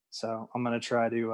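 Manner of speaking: ordinary delivery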